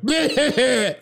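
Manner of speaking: high, silly alien bleat; alien noise imitation. Host imitating an alien from the first game, high bleat. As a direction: squeal it like a little game alien. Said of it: "one alien that was like".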